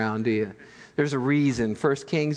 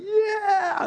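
high whooping cheer of victory; triumphant yell. Retold or imitated. Elijah cheering as fire torches the altar, high whoop. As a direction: shout it like a winner.

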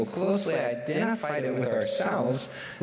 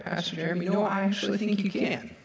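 ordinary talk, nothing marked

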